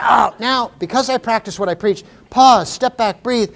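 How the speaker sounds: normal talking voice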